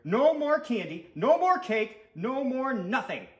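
high, loud string of refusals; fed-up boy laying down the law; defiant shout. A narrator's reading. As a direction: shout each ban with rising insistence. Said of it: "shouted Stuart".

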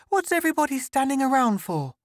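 put-on very high, quick child's question; puzzled little boy. A narrator's reading. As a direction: ask it fast, like a baffled young son. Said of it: "He stopped".

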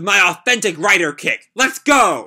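loud anime battle cry; hero shout. Acted. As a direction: yell the finishing move like an anime hero. Shinra's attack shout.

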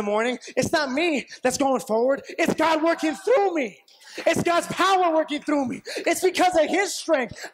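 rising, fervent preaching shouts. Acted.

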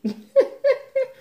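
squeaky burst of laughter; high laugh. Real, not acted. Laughs at own slip.

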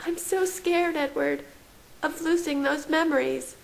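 high-pitched, fearful character voice; understated fear in a high woman's voice; scared woman. A narrator's reading.

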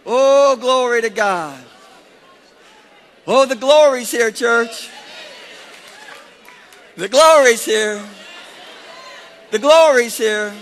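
loud, high cries of glory; exultant preaching. Real, not acted. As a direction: proclaim it with force and get the church to echo. Preacher exhorts church in praise.

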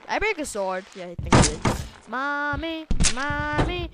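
ordinary talk

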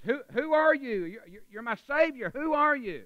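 high, loud, stammering questions; desperate astonishment. Acted. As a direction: cry out to your unseen rescuer. Man on cliff answers mystery voice.

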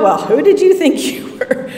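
mock lecture. Jesus mockingly scolding a sinking Peter, scoffing. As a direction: scold him with a mocking laugh.

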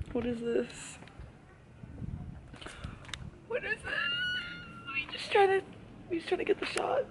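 high, whiny plea under the breath; high mock whine. Real, not acted. Waiting for a photographer, high quiet mock whining. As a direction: wail it in a small high voice. Stranger goes back, frustrated plea.

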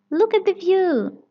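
high, loud amazed gasp; gasping in wonder. Acted. Madame Gazelle amazed at the mountain view, high and loud. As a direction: marvel at the view from the top. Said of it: "gasps Madame Gazelle".

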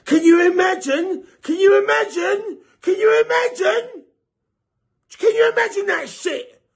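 boxing fan getting carried away with excitement; excited shouting. Real, not acted. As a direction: build the hype with each repeat. Building hype about Wilder and Mayweather.

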